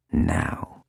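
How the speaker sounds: unvoiced urgent whisper; the voice in his ear whispers a cue. A narrator's reading. Faber urging through earpiece.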